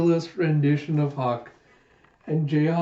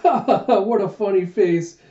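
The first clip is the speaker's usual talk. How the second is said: high, loud ha-ha running into words; mock laughter; 6 semitones higher, 5 dB louder than usual. Jayha laughing at his own poster, mock laughter. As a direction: laugh it out, hammed up.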